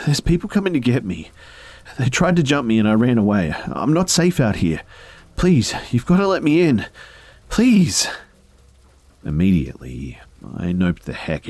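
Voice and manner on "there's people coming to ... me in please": high, loud, desperate pleading; panicked voice breaking. Acted. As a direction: beg as panic takes over. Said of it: "His voice starts breaking into a panic".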